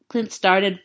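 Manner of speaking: usual speaking voice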